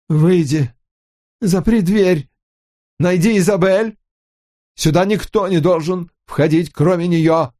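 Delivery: хриплый голос. A narrator's reading. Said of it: "хриплым голосом, но спокойно".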